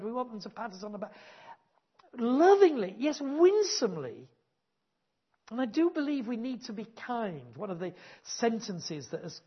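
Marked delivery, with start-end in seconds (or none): 2.2-4.3 lovingly and winsomely punched loud and high, winsomely drawn out slowly; emphatic. Real, not acted. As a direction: lean hard on each adverb. Stressing kindness in preaching.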